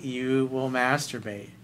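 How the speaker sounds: ordinary talk, nothing marked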